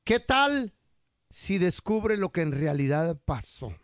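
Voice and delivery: suspenseful mock newscast tease; news teaser.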